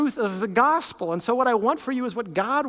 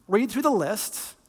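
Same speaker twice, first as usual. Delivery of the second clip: usual speaking voice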